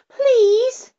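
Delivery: put-on the girl begs the fly-by-night, very high; girl crying out. A narrator's reading. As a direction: beg like a scared child. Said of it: "cried the little girl".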